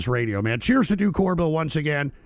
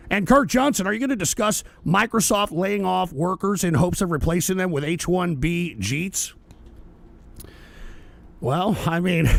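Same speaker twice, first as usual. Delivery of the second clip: ordinary talk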